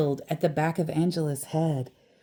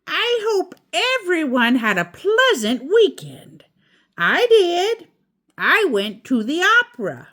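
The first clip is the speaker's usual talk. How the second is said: higher, louder teacher voice; teacher address; 11 semitones higher, 5 dB louder than usual. A narrator's reading. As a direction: greet the class grandly like a teacher. Teacher announces to class.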